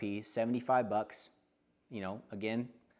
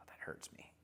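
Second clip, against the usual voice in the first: breathy pained whisper; 16 dB quieter than usual. Real, not acted. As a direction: whisper it as if it stings. Adding water to whiskey.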